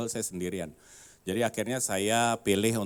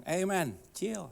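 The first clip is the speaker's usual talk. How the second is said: calming someone down; 8 semitones higher, slower than usual. Retold or imitated. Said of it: "Saya bilang".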